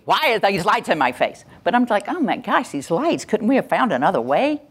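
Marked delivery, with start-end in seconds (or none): 0.1-1.5 worked-up, higher-pitched protest; emotionally upset. Shown as a demonstration. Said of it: "My emotional state would be". 2.1-4.6 whiny, higher-pitched little grumble; nagging complaint. Shown as a demonstration. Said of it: "kind of a little nagging complaining".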